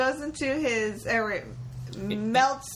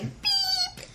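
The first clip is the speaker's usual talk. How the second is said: squeaky high beep sound; high mock beep. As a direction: chirp a high robotic beep. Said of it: "is like".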